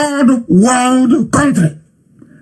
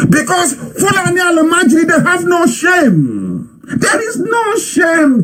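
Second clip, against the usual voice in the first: high, slow, outraged yell; furious shout; 8 semitones higher, slower than usual. Real, not acted. Angry rant at own people.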